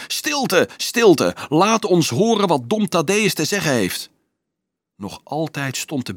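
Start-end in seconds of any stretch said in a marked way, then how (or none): 0.1-4.1 high-pitched, urgent yell. A narrator's reading. Carolus jumping on the rock demanding silence, yelling. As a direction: yell for the crowd to listen. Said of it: "gilde ook".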